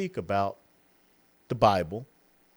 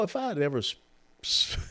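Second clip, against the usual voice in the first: ordinary delivery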